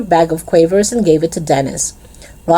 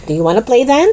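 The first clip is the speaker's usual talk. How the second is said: eager question, pitched up at the end; keen, hopeful invitation to play; 7 semitones higher, faster than usual. A narrator's reading. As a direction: ask keenly, voice raised high. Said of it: "asked Dervesh eagerly".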